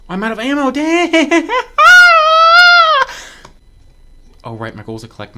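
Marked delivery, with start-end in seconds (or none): 0.1-1.5 high, loud outburst; rising high-pitched yell; frustrated scream. Real, not acted. Ammo runs out during fight. 1.5-4.4 long, very loud shriek; piercing drawn-out cry of dismay; sustained high-pitched wail; high wailing scream. Real, not acted. Screams after ammo runs out.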